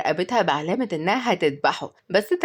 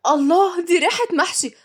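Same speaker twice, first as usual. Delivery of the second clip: higher, impressed cry of delight; delighted exclamation; 9 semitones higher than usual. Acted. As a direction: exclaim as if savouring the smell. Delighted reaction to food smell.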